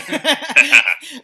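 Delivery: big burst of laughter; loud real laugh breaking out. Real, not acted. Laughs after his host joke.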